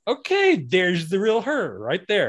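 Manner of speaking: high-pitched mock-discovery voice, stretched out slowly; retold pleased realization. Acting out own reaction to show.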